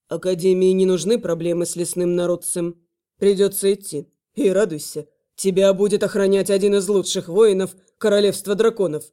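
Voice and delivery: low, measured male voice; low male dragon voice. Acted. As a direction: speak as a stern dragon guard. Velor explains duty.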